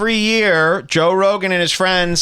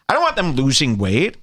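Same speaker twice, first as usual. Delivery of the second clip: ordinary talk, nothing marked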